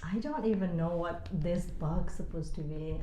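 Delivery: normal talking voice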